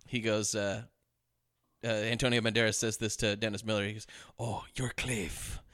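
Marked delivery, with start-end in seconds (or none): 4.4-5.6 put-on slow, softer Spanish-accented line. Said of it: "'Cause he's got an accent".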